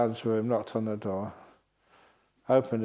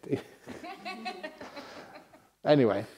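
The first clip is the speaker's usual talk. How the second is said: ordinary delivery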